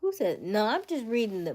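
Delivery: sudden loud, high-pitched retort; surprised outburst. Real, not acted. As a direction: jump in, startled and loud.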